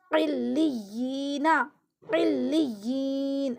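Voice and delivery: قلیینا drawn out slowly, syllable by syllable; slow spelled-out recitation. Shown as a demonstration. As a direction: stretch قلیینا with a clear ain sound. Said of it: "عین نکالنا ہے". (قلیینا کو آہستہ کھینچ کر پڑھیں)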